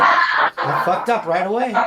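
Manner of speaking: half-laughing confession; amused, laughing and loud. Retold or imitated. Stoned retort, laughing through it.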